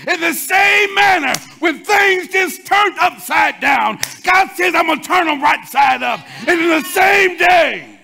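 loud, slow rising sermon climax; full-force preaching. Acted. Preacher lifting the church to a shout. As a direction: build the congregation up, hammering each phrase.

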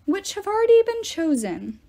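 commanding yell to rowdy campers. Acted. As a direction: shout it over the crowd. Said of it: "he yelled".

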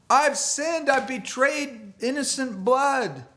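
anguished confession. Retold or imitated. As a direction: wail out the guilt. Judas crying out remorse.

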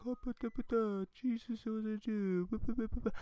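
high, silly nonsense voice; mock babbling. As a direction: babble it high and loose in mockery. Nonsense mocking quote.

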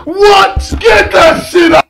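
loud, high shout. Real, not acted. Cracking up at the first clip, he shouts it out loud and high. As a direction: yell it out over the laughter.

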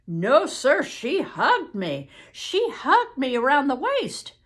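loud, emphatic Southern correction; agitated witness. Acted. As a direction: insist loudly, stretching the key words. Witness insists on account.

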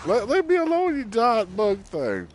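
high, loud shriek at the bug; panicked yell. Real, not acted. Player shrieking at the giant bug, high and loud.

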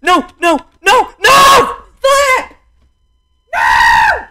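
repeated loud shouts, growing longer; distressed screaming. Real, not acted. Player failing on the bridge, distressed screaming. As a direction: scream in protest, drawing out the last ones. Losing in the game.

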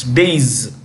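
"days" as one long syllable; taught pronunciation. Shown as a demonstration. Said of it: "this is the pronunciation".

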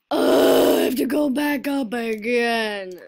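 very loud exasperated groan into a wail; loud gamer's complaint; frustrated outburst. Real, not acted. Fell in the game.

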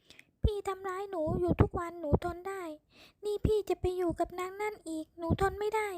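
woman crying out at him; tearful outburst. A narrator's reading. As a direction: pour out the hurt between sobs. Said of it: "ตามด้วยเสียงร้องไห้เป็นระยะระยะ".

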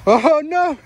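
high, playful oh no; mock panic about falling behind; playful alarm. Real, not acted. Playful panic losing game.

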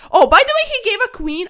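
sudden surprised outburst, high and loud; startled exclamation. Real, not acted. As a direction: jump up in surprise.

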